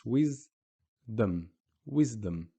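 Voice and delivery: "wisdom" held apart after wis; stop on the S. Shown as a demonstration. Teacher showing where we stop, split word. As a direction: pause on the S in "wisdom", then close on M. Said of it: "We stop on the S".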